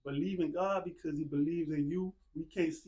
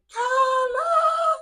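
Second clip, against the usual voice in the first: a long, very high, very loud call across the blocks; hollering the name out the screen door; grandma yelling name; 12 dB louder than usual. Retold or imitated. Grandma calling her grandson in at sundown, full-throated yell. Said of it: "She screaming".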